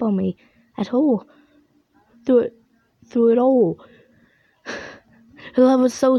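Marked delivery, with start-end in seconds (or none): none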